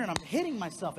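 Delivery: ordinary talk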